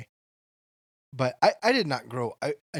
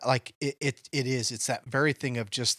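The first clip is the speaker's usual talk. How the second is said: ordinary talk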